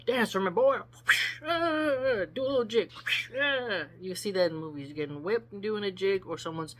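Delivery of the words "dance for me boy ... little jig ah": high, loud taunting cry; hammed-up cowboy taunt; mocking whip master. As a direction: goad him to dance the jig. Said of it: "So, like".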